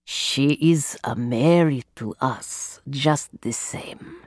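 low voice sinking to a whisper; cold hissing layered whisper. Acted. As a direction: trail off into a hushed whisper.

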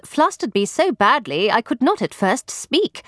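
ordinary delivery, nothing marked

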